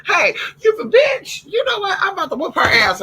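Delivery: shrieking scold. Real, not acted. Dog attacks other dog.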